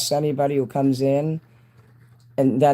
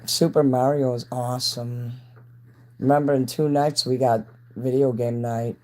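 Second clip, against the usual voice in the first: ordinary talk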